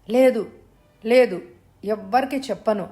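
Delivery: loud, high frightened denial; frightened promise. Acted. Mother promising kidnapper she will tell no one, loud and frightened. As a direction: blurt it out in terror.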